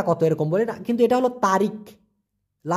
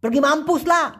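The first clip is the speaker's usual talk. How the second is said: mock rude snap; 6 semitones higher than usual. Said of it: "অনেকে আবেগের বশে বলে".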